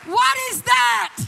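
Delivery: high, loud preacher's re-enactment; shouted demand. Retold or imitated. Father shaken by a presence in the foyer, shouting. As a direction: cry out, hammering each question.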